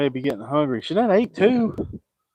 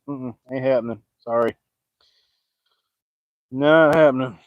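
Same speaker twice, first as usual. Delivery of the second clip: ordinary delivery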